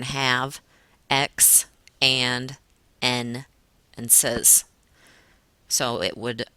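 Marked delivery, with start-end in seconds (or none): 0.0-4.6 have, X, and, N, says each set apart with pauses; stressed syllables picked out. Shown as a demonstration. Teacher pointing out stressed syllables, each one separately emphasized. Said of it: "stressed syllables on".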